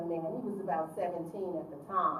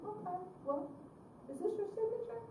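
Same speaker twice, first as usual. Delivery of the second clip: sly trap; 12 semitones higher, 5 dB quieter than usual. Retold or imitated. Counselor springing the trap.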